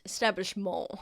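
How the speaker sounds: "Establishment" with a French-style ending, "-mawn"; French accent. Acted. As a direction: say "Establishment" as if it were a French word. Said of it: "he says it with a French accent".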